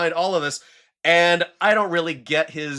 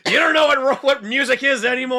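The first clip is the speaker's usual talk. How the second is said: put-on old man yelling at cloud; 9 semitones higher, faster than usual. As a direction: scold the young like a cranky old man. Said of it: "old man yelling at cloud".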